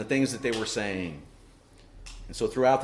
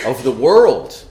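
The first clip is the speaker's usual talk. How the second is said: "world" hit hard and much louder; emphasis on world; 12 dB louder, slower than usual. Real, not acted. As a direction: drive home the word "world". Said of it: "the emphasis is on the world".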